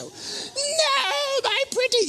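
high, laughing, crying witch wail; mock wicked witch. Preacher fearing a seatmate, mock witch wail. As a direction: ham up the horror like a movie witch.

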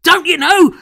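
put-on high, loud shout in an English accent; overacted English-accented cry. An extra bursting into the room, frantic English accent. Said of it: "doing it in an English accent".

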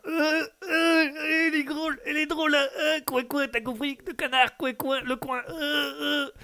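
high whiny laugh through the words; cackling at own joke. Acted. (tonton qui rit de sa propre blague, voix très aiguë)

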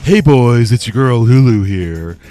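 man lightly doing a woman's voice; Lulu voice. Retold or imitated. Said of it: "That's just me doing, uh, your girl Lulu's voice".

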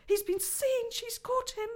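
put-on high, anxious girl's voice; a child sure the worst has happened; frightened Lucy. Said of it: "thought Lucy".

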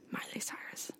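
a hushed whisper, leaning on the; whispered again. Acted. Host whispering the name again to her cohost. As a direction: whisper it conspiratorially.

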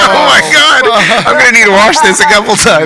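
high, loud, appalled wail through laughter; horrified laughing exclamation. Retold or imitated. Retelling finding the mess in the laundromat washer, comic horrified wail. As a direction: cry out in comic horror. Retells horror at the washing machine.

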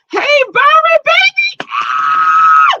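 delighted squeal to a commenter; excited greeting. Real, not acted.

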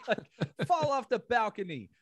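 laughing while urging her on. Real, not acted. Joking to a friend about the movie, laughing.

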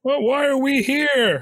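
high, loud and slow hammed-up wail; mock yell. Friend joins in mocking the victim's yell, loud and slow. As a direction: shout it like a horror movie victim. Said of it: "Mitch yells at the camera".

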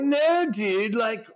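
high, slow, know-it-all bro voice; mock patronizing industry guy; imitating a condescending bro. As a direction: correct them like they're clueless. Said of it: "Or they're like".

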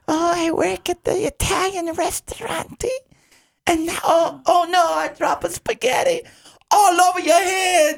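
squeaky, very high fake Italian accent; deliberately awful falsetto Italian waiter; Pistachio. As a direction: wail it in bad high-pitched Italian. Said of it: "it really does sound this bad, this impression".

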